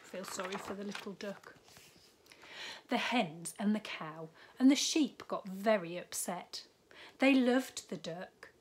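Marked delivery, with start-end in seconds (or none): none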